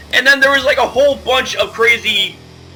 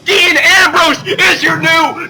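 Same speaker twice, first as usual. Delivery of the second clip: hyped announcer call; 6 semitones higher than usual. Retold or imitated. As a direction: belt it out like a ring announcer crowning the champ. Recaps Ambrose cash-in like announcer.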